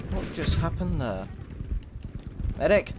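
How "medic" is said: calling out for a medic; man down shout. Real, not acted. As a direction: shout for help across the map.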